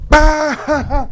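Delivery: sudden high, loud "bah" of laughter; burst of laughter. Retold or imitated. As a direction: imitate laughter exploding out of nowhere. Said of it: "we start fucking dying laughing".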